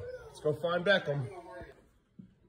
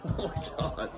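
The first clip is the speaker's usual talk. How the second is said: ordinary delivery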